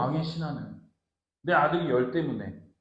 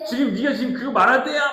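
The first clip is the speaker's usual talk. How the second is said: higher-pitched indignant mock scolding; angry telling-off; 6 semitones higher, 4 dB louder than usual. Annoyed Jesus scolding the interrupting official, indignantly. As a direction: rebuke him for bad timing. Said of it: "막 이렇게 막 화".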